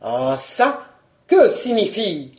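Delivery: a touch of a puzzled husband; questioning baron. Acted. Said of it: "interrogea le baron".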